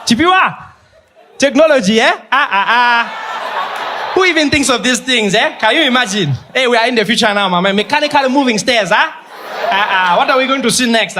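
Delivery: high, wonder-struck mock awe; amazed old-timer. Mall visitor marveling at escalators, hammed-up awe. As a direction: gush at the marvel of moving stairs.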